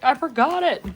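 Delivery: loud, high mock wail; retold exclamation. Hiker admits forgetting retainer, high mock wail. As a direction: wail it as a playful confession. Acting out her own past exclamation.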